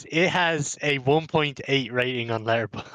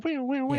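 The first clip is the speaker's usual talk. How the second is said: high-pitched, playful whee sounds; light, high mimicry of a swinging gymnast; imitated wee noises; 14 semitones higher, 4 dB quieter than usual. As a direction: squeal like someone swinging on gym gear. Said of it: "look at that fella going".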